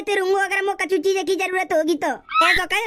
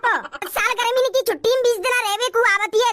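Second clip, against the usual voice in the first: higher scolding rant; 4 semitones higher than usual. Acted. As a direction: accuse her of visiting just to freeload. Brother's wife scolding sister. (भाभी का ऊँची आवाज़ में ताना)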